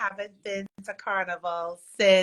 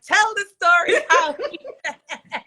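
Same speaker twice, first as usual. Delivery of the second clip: high, louder laughing quote of a friend; 8 semitones higher, 6 dB louder than usual. Retold or imitated. Quoting Tanya's request to the hosts, high and laughing. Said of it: "And Tanya's on here like".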